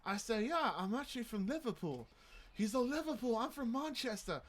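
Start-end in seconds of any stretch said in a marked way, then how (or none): 0.5-2.1 higher, put-on Liverpool British accent. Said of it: "Let's see how long I can do this for". 2.8-4.4 higher imitated Manchester British accent. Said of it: "you guys are doing it pretty good".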